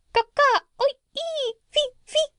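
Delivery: high squawking parrot calls; bird language squawk. Acted. Parrot showing the doctor bird language, high squawk. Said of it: "But hear this".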